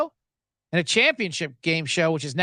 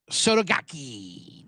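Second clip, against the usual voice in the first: slow drawn-out mock Japanese accent on "Sotogake"; 4 semitones lower, much slower than usual. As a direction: stretch "Sotogake" out slowly in a put-on Japanese accent. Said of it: "picked up on the accent".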